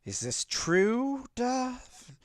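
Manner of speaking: high, slow questioning character voice; high squeaky voice. Acted. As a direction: squeak it out slowly.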